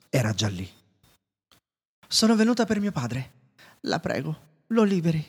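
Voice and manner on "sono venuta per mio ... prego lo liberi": high, imploring voice; pleading Belle. A narrator's reading. Belle begging the beast to free her father, high and imploring. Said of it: "supplicò Belle".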